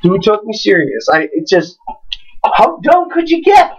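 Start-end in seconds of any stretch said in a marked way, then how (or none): 2.4-3.7 incredulous. Retold or imitated. Ranting about the pizza guy.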